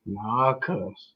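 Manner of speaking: low, hushed muttering; dejected refusal. Retold or imitated. Fan answering cousin who says calm down, dejected muttering. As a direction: mutter it softly, refusing to be consoled. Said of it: "Cuz, calm down, cuz".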